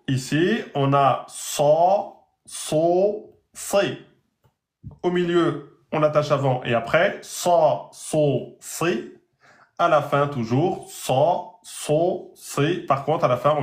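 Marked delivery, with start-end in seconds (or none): 0.7-4.0 "sa, so, si" backed and heavy; emphasize each syllable deeply; emphatic sad vowels. Shown as a demonstration. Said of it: "il faut emphatiser". 7.2-9.1 "sa, so, si" backed and heavy; emphasize each syllable deeply; emphatic sad vowels. Shown as a demonstration. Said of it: "il faut emphatiser". 10.9-12.8 "sa, so, si" backed and heavy; emphasize each syllable deeply; emphatic sad vowels. Shown as a demonstration. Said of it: "il faut emphatiser".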